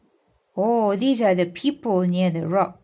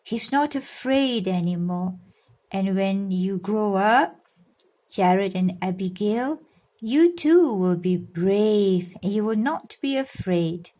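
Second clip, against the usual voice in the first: ordinary delivery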